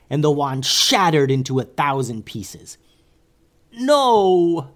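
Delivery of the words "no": high, loud cry of protest; wizard's yell. A narrator's reading. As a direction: wail it out in dismay, much higher. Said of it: "yelled one of the wizards".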